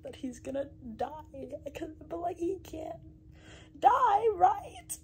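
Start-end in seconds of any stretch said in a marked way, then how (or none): 2.2-5.0 pleading, climbing to a loud, much higher cry; scared plea. Real, not acted. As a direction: beg the book not to let him die. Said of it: "because I'm scared".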